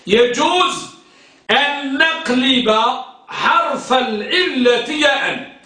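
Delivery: slowed, stressing each phrase for dictation; deliberate dictation pace. Shown as a demonstration. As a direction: spell it out slowly for the class. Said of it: "ركز،".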